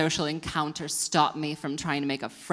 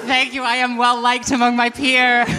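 much louder and higher, showy delivery; big showbiz boast; loud hammed-up take; 7 semitones higher, 12 dB louder than usual. Shown as a demonstration. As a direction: blast it out like a proud announcement. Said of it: "Should we do it a third time?".

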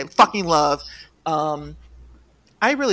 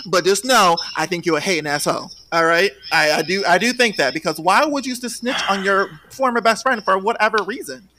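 ordinary talk, nothing marked

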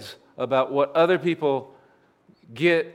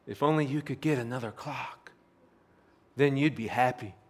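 soft, hushed tempting voice, much softer; eerie whisper; 2 semitones lower, 6 dB quieter than usual. Acted. Whispering voice taunting the clock collector, hushed. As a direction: breathe it like a voice in his head. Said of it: "And the whispering would said".

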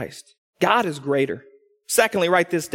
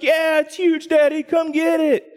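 put-on high, loud excited kids' shout; kids shouting; 9 semitones higher, 3 dB louder than usual. Kids calling daddy down to the spider, high excited shouting. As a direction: play the kids yelling for daddy. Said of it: "my kids are like".

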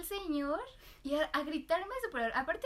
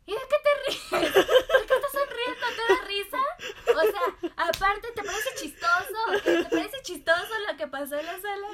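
much higher, louder furious shouting; furious yell; 9 semitones higher, 12 dB louder than usual. Retold or imitated. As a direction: imitate the man screaming in his fury. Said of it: "en toda su furia". (el señor grita furioso a la cajera)

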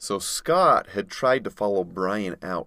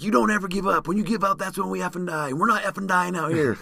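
yelling quote; 10 semitones higher than usual. Retold or imitated. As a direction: shout it in his face to keep him going. Brian shaking him, yelling.

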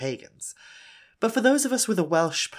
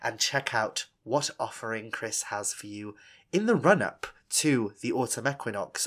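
ordinary talk, nothing marked

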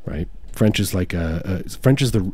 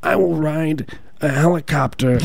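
Bernie Sanders; 6 semitones higher than usual. Acted. Mocking a politician's helicopter pledge, high put-on voice. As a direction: stretch it out in a raised, put-on voice. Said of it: "He's like, he's like".

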